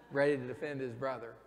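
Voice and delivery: usual speaking voice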